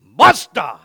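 explosive, loud "Basta"; forceful demo. Shown as a demonstration. Preacher showing the congregation how to say it, forceful shout. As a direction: punch out "Basta" with full force. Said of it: "you gotta do it like this".

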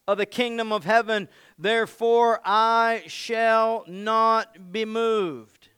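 declaring faith firmly; bold declaration. Acted. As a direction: proclaim it like a vow. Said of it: "Start declaring his name".

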